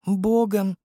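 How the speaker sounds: gently voiced young girl; Nastya's voice. A narrator's reading. Nastya answers. (высокий девичий голос)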